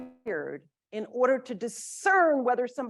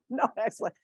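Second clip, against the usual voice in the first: louder, quick, laughing at yourself; amused laughing; 6 dB louder, faster than usual. Real, not acted. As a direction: laugh through the words. Laughing at own odd action.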